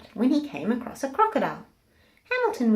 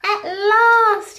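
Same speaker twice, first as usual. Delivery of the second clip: relieved cry; 13 semitones higher, 11 dB louder than usual. Acted. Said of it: "he sighed".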